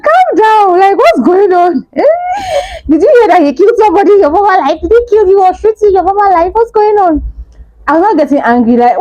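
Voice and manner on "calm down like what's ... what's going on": disbelieving high shout at mom; exasperated outburst. Retold or imitated. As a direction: throw the questions at her, voice cracking high. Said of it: "I'm like".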